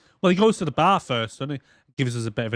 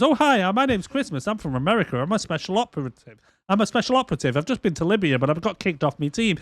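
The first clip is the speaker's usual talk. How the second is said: mock chirpy introduction, higher and bouncy; over-eager oversharing; 4 semitones higher than usual. Christmas introducing himself to a random woman, mock eager. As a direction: gush a whole backstory at a stranger. Mocking Christmas info-dumping on stranger.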